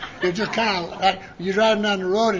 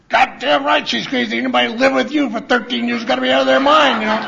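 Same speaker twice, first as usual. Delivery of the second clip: mock yelling Nick; 4 semitones higher, 6 dB louder than usual. Nick's loud retort retold.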